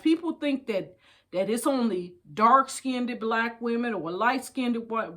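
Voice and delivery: ordinary talk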